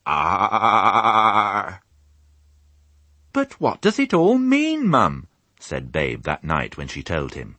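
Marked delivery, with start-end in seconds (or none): 0.1-1.8 loud sheep chorus; sheep murmur. Acted. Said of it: "the murmur came back". 3.3-5.3 high, louder piglet voice; little pig Babe asking; young piglet. Acted. Piglet Babe asks his mum.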